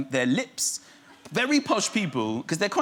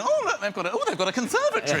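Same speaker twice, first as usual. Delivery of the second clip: nosy bouncing; 8 semitones higher, faster than usual. Retold or imitated.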